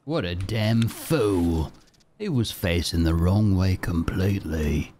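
hammy Aussie put-on voice; mock Australian accent. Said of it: "I'll stop talking like that".

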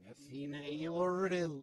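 ordinary talk, nothing marked

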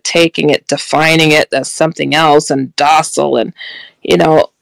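ordinary talk

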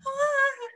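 very high, mock tearful shriek; retold crying wail. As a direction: wail "ah" high, reliving the tears. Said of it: "Like, I'm crying".

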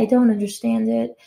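ordinary talk, nothing marked

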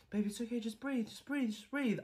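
high, very soft cooing; soft soothing. Acted. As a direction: hush a scared sweetheart into breathing. Calms scared partner softly.

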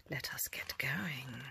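hushed, breathy start; whisper. Real, not acted. Teacher whispering to children at bedtime. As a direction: whisper to settle them down.

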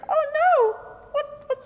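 high fast panicky squeal; panicked. Acted. Seed siblings panic as they fall, high and fast. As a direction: scramble in alarm.

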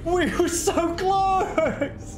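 high, slow wail of defeat; anguished outcry. Real, not acted. Player losing so close, anguished outcry. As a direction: wail it out, drawing the words long.

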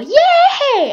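high, loud drawn-out cheer; gleeful cheer. Real, not acted. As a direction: burst into a celebratory yay. Cheering own game progress.

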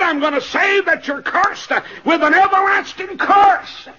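high, loud pulpit proclamation; preacher's ringing shout; thundering judgment. Real, not acted. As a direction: proclaim the curse at full force.

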